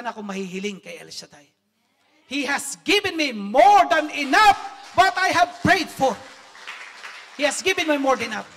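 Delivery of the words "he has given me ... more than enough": fervent proclamation. Real, not acted. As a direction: proclaim it with all your heart. Testimony climax to congregation. (malakas na pagpapahayag)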